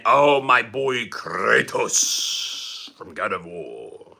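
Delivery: very slow, drawn-out epic delivery; theatrical and lingering, dying away at the end; hammed-up reverence. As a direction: stretch it out like an epic hero's introduction. Hammed-up salute to game hero.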